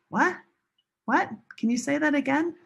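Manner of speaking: high, questioning pitch that climbs at the end; puzzled listener. Acted. Listener failing to understand a learner, puzzled high query. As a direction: play someone who didn't catch it. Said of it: "and people say".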